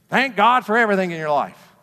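preacher sneering at TV preachers with a loud mock sermon. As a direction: mimic a TV preacher working the crowd. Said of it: "you'll hear them preaching".

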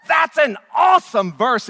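loud, excited exclamation; awesome!. Real, not acted. Preacher to congregation after the reading, excited. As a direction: shout it with delight.

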